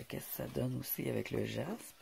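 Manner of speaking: ordinary delivery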